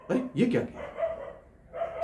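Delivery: slow, whispered disbelief; hushed shock. Acted. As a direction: drop to a stunned, drawn-out whisper. Shocked reaction at the climax. (दबी आवाज़ में हैरानी)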